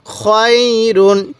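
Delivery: খয়রুন with the "khoy" glide held long; drawn-out recitation. Shown as a demonstration. Teacher modelling the word, খয়রুন slow and drawn out. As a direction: stretch খয় slowly, then close on রুন. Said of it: "কীভাবে পড়ব?".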